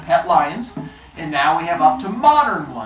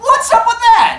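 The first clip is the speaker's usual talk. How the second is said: high, loud protest from a bullied turtle; indignant turtle; 7 dB louder than usual. Acted. Bullied turtle confronts the rabbit who knocked his books down, indignant. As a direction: protest loudly, pitched well up.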